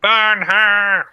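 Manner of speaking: mob shout. Pretend. Mock mob calling out.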